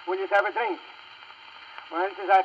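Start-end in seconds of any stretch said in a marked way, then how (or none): none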